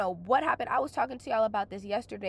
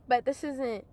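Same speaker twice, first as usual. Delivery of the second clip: ordinary delivery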